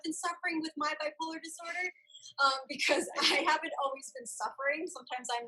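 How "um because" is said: ordinary delivery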